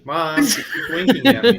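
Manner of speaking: put-on high, loud whiny kid, laughing through it; whiny tattling kid. Host voicing the chirping bird as a kid tattling to mom, high and laughing. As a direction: squeal it like a child telling on a sibling.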